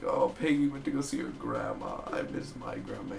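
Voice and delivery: mock crying. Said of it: "So she's crying like".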